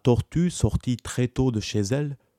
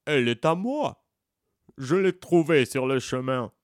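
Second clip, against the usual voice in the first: a touch higher, teasing tortoise; mild mocking tortoise; lightly voiced tortoise; 3 semitones higher than usual. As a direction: echo the monkey's lie back at him. Tortoise mocks monkey's words.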